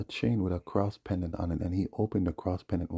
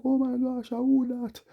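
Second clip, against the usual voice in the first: high, mock-frightened woman's voice, much higher; mock scared woman; 4 dB louder, slower than usual. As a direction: imitate her panicking, squeaky and high. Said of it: "she, she afraid".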